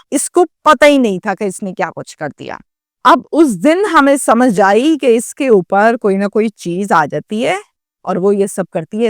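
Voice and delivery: ordinary delivery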